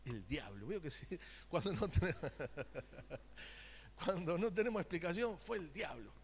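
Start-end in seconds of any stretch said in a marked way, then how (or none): 1.3-4.0 se le escapa la risa al hablar; riendo. Real, not acted. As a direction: empieza la frase y se quiebra de risa. Breaks up laughing at own remark. (entre risas)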